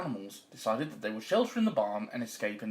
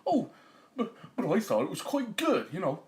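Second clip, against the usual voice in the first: higher, faltering protest; sheepish farmer; 4 semitones higher than usual. Acted. Farmer defends his voice to wife, sheepish. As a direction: stammer out a hurt defence.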